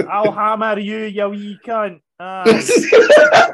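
loud Glasgow street threat; Glasgow street accent. Pretend. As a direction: spit the threat like a Glasgow ned. Scottish hard man bit.

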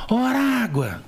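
high-pitched call across a stadium; imitated shout. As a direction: call out like hailing someone far off. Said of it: "Aí um cara grita assim".

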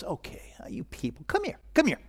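hushed, unvoiced whisper, coaxing like a softie; mock a God who only wants hugs, whispered and breathy-soft; whispered parody of an indulgent God beckoning sinners for a hug. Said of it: "and he just goes".

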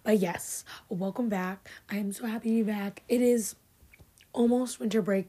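usual speaking voice

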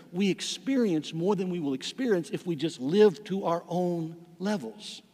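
ordinary talk, nothing marked

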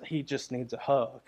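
a hint of a tender mother pleading for a hug; gentle wife. Pastor imitating his wife speaking softly about their child. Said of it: "my wife comes along and says".

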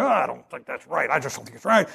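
soft, low mock whine; whiny complaint. The sexual self grumbling on the way out, a soft low whine. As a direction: moan it as you slink out. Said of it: "They moan, they groan".

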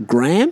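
"Graham" as "Gram", rising in disbelief; American pronunciation. Shown as a demonstration. Questioning the American version, incredulous one-syllable name. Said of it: "the Americans call it".

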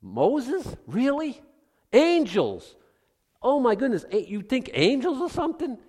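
high-pitched scoffing disbelief; mock astonishment, voice jumping up; incredulous. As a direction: question it as if it were absurd. Mocking reliance on Moses and angels.

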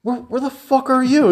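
high-pitched, indignant retold comeback; exasperated retort. Retelling a phone call to a friend after a fight, exasperated. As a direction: throw the question back in mock exasperation.

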